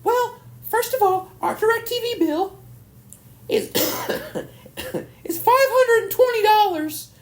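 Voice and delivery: very high, slow imitated grandma. Grandma tells grandson the bill, very high voice. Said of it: "She's like".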